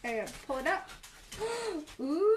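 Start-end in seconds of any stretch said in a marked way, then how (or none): 1.3-1.9 sharp soft intake of breath in wonder; delighted gasp. Real, not acted. Painter at her live stream gasps in delight.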